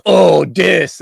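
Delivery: mocking gloat.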